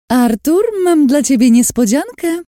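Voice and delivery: high, loud singsong call. A narrator's reading. Lin calls to the caged prisoner, loud taunting shout. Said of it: "krzyknęła Lin".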